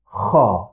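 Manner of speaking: a scraping, snore-like Kha; Arabic kha. Shown as a demonstration. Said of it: "we pronounce it as kha".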